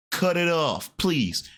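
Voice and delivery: ordinary talk, nothing marked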